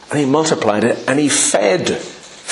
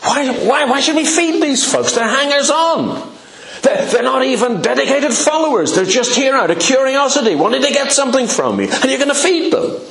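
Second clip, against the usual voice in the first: higher pitched mock complaint, exasperated; irritated grumbling; 12 semitones higher than usual. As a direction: grumble like fed-up disciples. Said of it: "You can almost hear them thinking".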